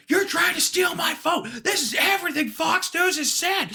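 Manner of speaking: high, shouted rant from the voter; mock furious shout. Angry voter shouting at poll workers, high mock yell. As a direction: yell it like he's lost it. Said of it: "loses it, like, immediately, he's like".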